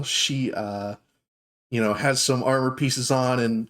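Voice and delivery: ordinary delivery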